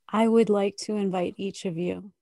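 ordinary talk, nothing marked

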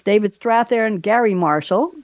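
ordinary talk

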